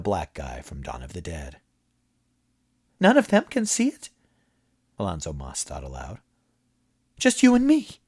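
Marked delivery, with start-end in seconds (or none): 3.0-4.1 much higher, urgent frightened asking; a nervous man begging for answers; alarmed high questions. A narrator's reading. Moss alarmed. 7.2-8.0 much higher, urgent frightened asking; a nervous man begging for answers; alarmed high questions. A narrator's reading. Moss alarmed.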